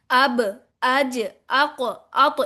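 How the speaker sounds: अब, अज, अक, अत each released with a short echoing bounce; qalqala demo. Shown as a demonstration. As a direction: bounce the final consonant of अब, अज, अक, अत. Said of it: "कलकला इस तरह करते हैं".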